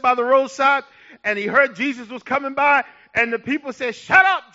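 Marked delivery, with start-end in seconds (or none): 3.9-4.5 loud, high snap to silence someone; sudden raised-voice hushing of a beggar; acted shout. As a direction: shut him up sharply, acting the crowd. Crowd silencing the blind man.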